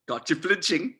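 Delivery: laughing through the taunt; mocking laugh. Landa gloating over the flinching prisoner, laughing mockery. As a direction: chuckle as you gloat.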